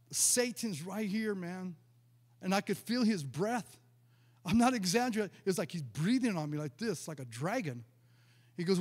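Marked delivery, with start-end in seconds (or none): none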